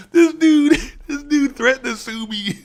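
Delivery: high, loud disbelief at being sued; incredulous outburst. Real, not acted. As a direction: shout it like it's absurd. Outraged about being sued.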